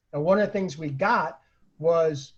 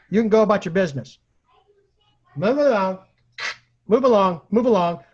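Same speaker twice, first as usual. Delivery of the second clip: louder, fast, mechanical repeating; entranced repeating; 6 dB louder than usual. Retold or imitated. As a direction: recite the words like a hypnotized soldier, louder. Sam dazed, repeating the mind trick.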